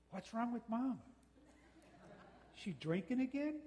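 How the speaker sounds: high, hammed-up kid asking dad; mock worried kid. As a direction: play a nosy child fretting about mom. Kid asking dad about mom.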